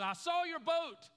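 a breathless schoolgirl bursting with news; excited schoolgirl telling the boy she found his boat; excited little girl. Retold or imitated. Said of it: "She said".

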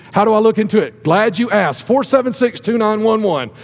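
usual speaking voice

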